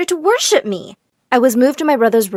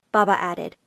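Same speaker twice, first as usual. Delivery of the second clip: ordinary delivery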